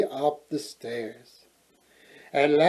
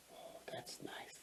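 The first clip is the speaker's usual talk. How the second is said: whispering; 33 dB quieter than usual. Real, not acted. Listener aside whispered.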